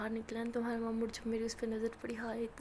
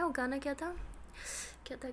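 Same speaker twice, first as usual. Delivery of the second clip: ordinary delivery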